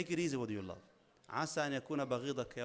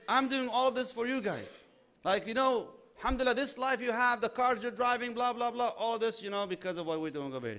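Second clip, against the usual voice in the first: bragging husband; 8 semitones higher, 4 dB louder than usual. Retold or imitated. Husband bragging voiced in lesson.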